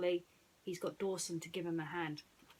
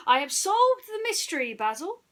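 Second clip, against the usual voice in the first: high, loud proud announcement; triumphant Dawson; 10 semitones higher, 11 dB louder than usual. Acted. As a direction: savour the win. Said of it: "Dawson said".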